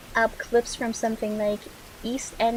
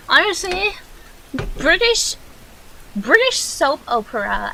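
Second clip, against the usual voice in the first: incredulous outburst; 6 semitones higher, 4 dB louder than usual. Real, not acted. Disbelieving outburst at British soap.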